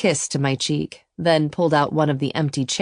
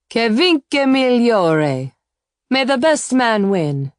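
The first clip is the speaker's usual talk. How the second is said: put-on slow, higher, louder Italian-accented toast; 7 semitones higher, 5 dB louder, slower than usual. A narrator's reading. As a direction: savour each word with an Italian accent. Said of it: "his lovely Italian accent".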